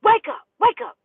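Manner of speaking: loud high yell to wake him; urgent shouted wake-up. Retold or imitated. Shaking a sleeping man awake, yelling. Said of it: "I keep grabbing him by his head like".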